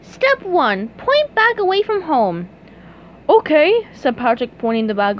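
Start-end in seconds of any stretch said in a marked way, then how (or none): 0.0-2.5 high cartoon voice reading out instructions; SpongeBob reading. A narrator's reading. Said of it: "He began to read". 3.3-3.9 high, loud cartoon okay; Patrick answering. A narrator's reading.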